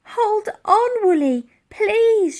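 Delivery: high, loud, slow plea; pleading cry. Acted. Elsie begging the sinking mammoth, crying out. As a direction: beg her friend to hold on. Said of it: "she cried".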